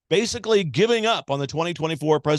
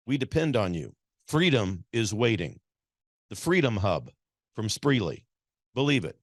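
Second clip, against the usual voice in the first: ad read; 4 dB quieter than usual. Acted. Host reads sponsor ad.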